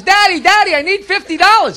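lightly voiced daughter, pitched high; pleading daughter. Girl runs up to her father for money, high pleading.

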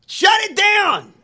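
slow, loud, high shout of mock outrage; outraged shout. Real, not acted. Podcast host yelling at a university to shut it down, loud and slow. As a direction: bellow it like a protest chant.

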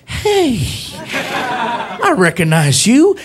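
high, drawn-out starstruck recognition; police officer. Acted. Officer recognizes comedian at car window, slow swooping delight. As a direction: stretch it out, pitch swooping up. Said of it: "The police officer comes over to the window".

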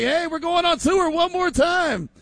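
high hyped shouting call to get the band on tour; rally the crew like a reunion tour hype man. Retold or imitated. Host imitating a band calling everyone back on tour, high and hyped. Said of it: "Remember, they're like".

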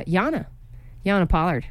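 usual speaking voice

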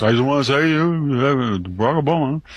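a hint of Obama playing to a crowd; mimicking Obama, understated; mocking Obama's put-on accent.

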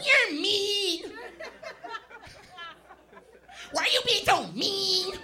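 very high, loud whiny falsetto; hammed-up girlfriend whine; annoying girl voice. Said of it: "That voice is annoying".

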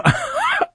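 quick high chuckle mid-quote; short amused laugh. Real, not acted. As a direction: laugh at the movie line. Jurassic Park quote.

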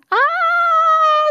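long, high, loud scream. A narrator's reading. Baby Bird meets a dark shape, high frightened scream. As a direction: let out a drawn-out shriek. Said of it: "screamed Baby".

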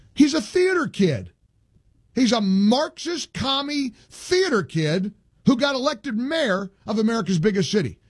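high-pitched, incredulous political tirade; worked-up outrage in a high voice; indignant denunciation. Real, not acted. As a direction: pitch it way up and drive the accusation home. Host denounces the mayor.

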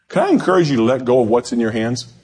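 rising fervent preacher's call; preaching. Real, not acted. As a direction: start to preach with urgency. Preaching builds from plain talk.

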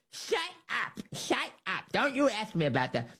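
high, soft mocking Pelosi voice; Pelosi impression. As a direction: squeak out shut up like an upset old woman. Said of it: "Nancy Pelosi's version of that".

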